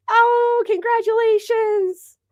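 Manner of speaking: very high, drawn-out squeal; gushing congratulations. Real, not acted. Streamer congratulating a viewer on a baby, gushing. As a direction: coo it way up high and stretch it out.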